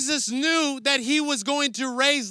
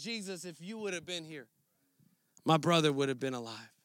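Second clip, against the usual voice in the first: much softer and lower, broken plea; heartfelt, grieving; 7 semitones lower, 8 dB quieter than usual. Retold or imitated. Mary at Jesus' feet, grieving her brother, hushed and aching. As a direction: pour out your grief quietly to Jesus. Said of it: "she opens up her heart".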